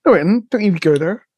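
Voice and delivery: normal talking voice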